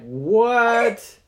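high, loud disbelief; shocked what. Acted. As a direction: gasp it out stunned. Girl shocked.

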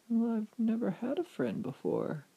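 high, tentative character voice; lonely witch. A narrator's reading. Witch answering John's offer of friendship, high wistful voice. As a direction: admit loneliness as a softened witch.

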